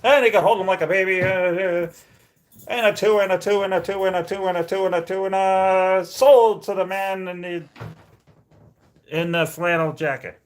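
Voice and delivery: fast, loud auction chant; auctioneer. Pretend. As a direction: rattle off bids like an auctioneer. Mock auction of the dog.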